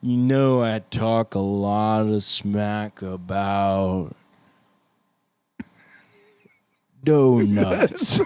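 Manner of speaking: very slow, with an endless pause before the last word; dragged-out slow-motion speech, huge pause then a loud finish; half-speed playback. Retold or imitated. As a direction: imitate a podcast slowed to half speed. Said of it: "even the shortest pause becomes an eternity on half speed".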